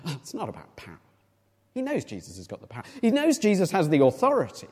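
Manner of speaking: ordinary talk, nothing marked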